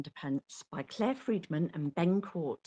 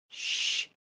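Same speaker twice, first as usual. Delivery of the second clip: shush; 5 dB quieter than usual. A narrator's reading. Sneaking up on sleeping pirates.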